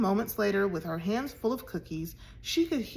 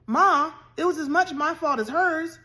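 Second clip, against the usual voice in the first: higher, louder protest; crying out; 7 semitones higher, 6 dB louder than usual. A narrator's reading. Tom defending Caddy to his mother, crying out. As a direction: blurt it out to defend her. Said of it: "cried Tom".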